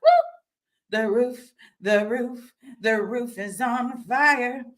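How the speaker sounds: high whooping party chant; party cheer. Retold or imitated. Chanting to herself about wanting to let loose. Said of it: "there's a part of me that wants to be like".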